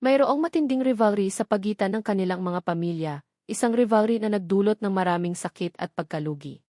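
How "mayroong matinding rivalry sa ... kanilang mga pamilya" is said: plain reading voice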